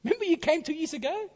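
lightly voiced teenage student; student's voice. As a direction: lift up into a young student. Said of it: "he said".

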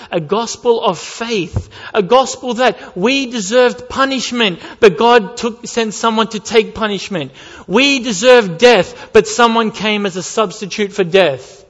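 loud, high, driving sermon voice; fervent preaching. Acted. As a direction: preach it hard to the congregation. Preaching at full force.

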